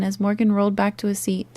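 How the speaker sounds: usual speaking voice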